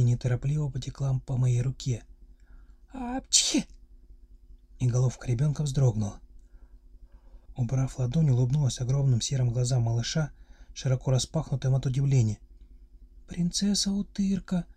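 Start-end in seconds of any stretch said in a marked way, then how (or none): 2.9-3.7 high sneeze. A narrator's reading. Child sneezes. 13.3-14.6 put-on child whisper. A narrator's reading. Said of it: "прошептал ребёнок".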